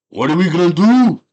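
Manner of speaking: loud high Conan outburst; mock panicked yell. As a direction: holler it in comic alarm. Conan stranded on tower, acted.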